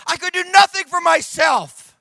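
high, loud preaching shout in one run; emphatic shout. Real, not acted. Preacher repeating his point to congregation, emphatic shout.

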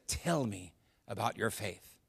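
hushed, low and earnest; serious. Retold or imitated. Tour guide, done joking, asks the couple about faith, seriously. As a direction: drop right down and ask it softly, in all seriousness. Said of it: "replaced with a serious, serious look".

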